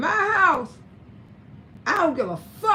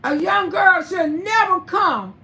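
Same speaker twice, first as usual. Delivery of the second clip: ordinary delivery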